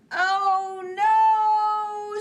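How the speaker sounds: high, loud wail of alarm; dismayed scream. Acted. Bear and Mole screaming as their kite spins away, high and loud. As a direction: cry out as the kite falls. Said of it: "screamed Bear and Mole".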